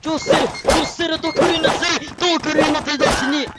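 hollering pretend foreign words while charging; gibberish battle cry. Charging the enemy, he shouts a mock foreign battle cry. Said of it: "I do not know Japanese, I'm just gibberish".

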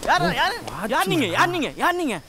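high, thin-volume flustered questioning; panicked. Acted. Caretaker startled by strangers, panicked. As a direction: repeat it in a fluster, high and soft. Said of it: "ஏய், பதறாத".

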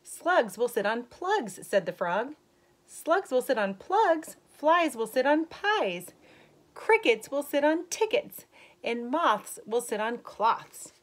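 put-on slow, lilting read-aloud voice for kids; story reading. Teacher reading a rhyming book to young kids, lilting. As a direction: read the rhyme brightly to the class. Reads picture book to children.

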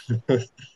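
a short real chuckle; brief laugh. Real, not acted. Chuckling after pitching the podcast idea to Doug. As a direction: laugh at his own pitch.

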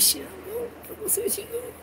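very soft, high-pitched murmur; hushed excited aside. Acted. Inmate to himself on being picked, hushed and excited. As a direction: squeak it under your breath. Acting out his hushed reaction.

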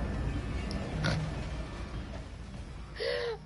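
silent breathless laughter; wheezing laugh. Real, not acted. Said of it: "I'm laughing".

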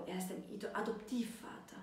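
hushed whisper, breath only. A narrator's reading. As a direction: let the word slip out in a whisper. Narrator reads character's whispered correction. (geflüstert, nur gehaucht)

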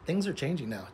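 normal talking voice

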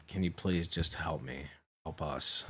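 subdued, understated begging; retold begging. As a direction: plead quietly, as if recounting it. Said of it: "I literally begged".

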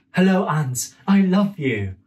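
put-on high, sweet little boy. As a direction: say it like a small child, high up. Child Dimitri voiced in story.

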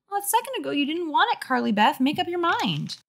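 put-on high little-kid voice; bratty younger brother. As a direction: talk back like a small boy. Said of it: "This is his voice".

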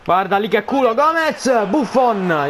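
alarmed shout. Real, not acted. Gol quasi subito.

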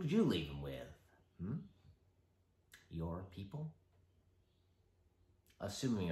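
soft, low, teasing Joker; icy polite interrogator. Acted. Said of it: "interrogation scene involving ... the Joker".

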